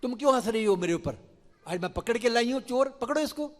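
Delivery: fast, loud and high, rising to a squeal; offended, demanding to be taken seriously; indignant gopi. Acted. Gopi scolds the laughing women, fast loud indignation.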